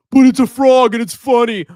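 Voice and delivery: high, loud mock-silly excuse; mock Pepe fan voice. Mocking Pepe fans.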